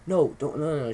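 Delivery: mild imitation of mom cutting him off; retold motherly refusal, a touch angry; lightly acted cross mom. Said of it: "she was angry".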